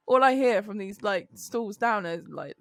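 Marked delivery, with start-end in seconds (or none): none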